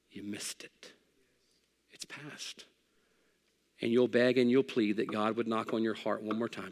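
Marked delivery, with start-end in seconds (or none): 0.1-2.6 hushed warning. Acted. Warning the congregation they missed salvation.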